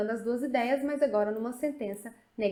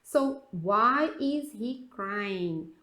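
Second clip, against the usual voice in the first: very slow, each word drawn out; slow teaching read; much slower than usual. Shown as a demonstration. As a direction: read it slowly for the class. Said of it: "O primeiro aqui, why?". (leitura lenta de professora)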